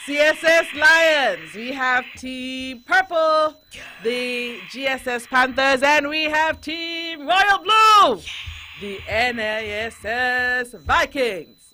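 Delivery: loud, high, stretched-out arena announcer; slow, full-volume hype introduction; host voice. Pretend. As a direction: belt out each team name with long held words. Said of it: "that was my host voice".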